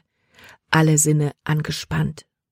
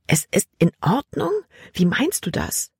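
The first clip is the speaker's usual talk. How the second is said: higher, indignant echo question; angry disbelief; 5 semitones higher than usual. A narrator's reading. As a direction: throw "in Ordnung" back with a sharp rise. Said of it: "Libby war wütend". (wütend und ungläubig nachgefragt)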